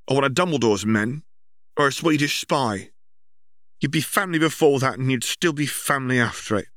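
a firm mother laying down the law; stern mother. Acted. Mother scolds her children at the party, stern.

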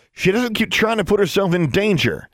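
high, heated complaint; exasperated snapping. A narrator's reading. Brother scolding his reckless sister, exasperated. Same snapped retort goes on.

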